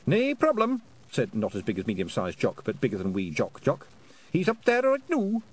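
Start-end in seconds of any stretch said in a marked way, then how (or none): 0.1-0.8 high squeaky Scottish pixie; tiny Scots warrior, chirpy and high; Feegle voice. Acted. Feegle agrees. 4.3-5.4 high squeaky Scottish pixie; tiny Scots warrior, chirpy and high; Feegle voice. Acted. Feegle answers.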